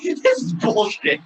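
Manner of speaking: high, drawn-out mock protest; mock frustration. Imitating the wrestler's reaction for laughs, mock frustration.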